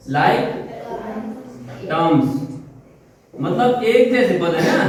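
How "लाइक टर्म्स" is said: Like and terms said as two separate words with a long pause between; slow, spaced-out teaching. Shown as a demonstration. Teacher drilling students on a new term, slowly word by word. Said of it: "like terms बोलते हैं".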